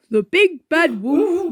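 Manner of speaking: usual speaking voice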